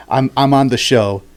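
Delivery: ordinary delivery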